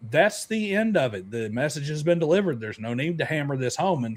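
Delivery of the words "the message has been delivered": normal talking voice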